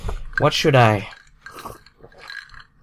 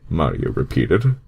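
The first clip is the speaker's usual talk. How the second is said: usual speaking voice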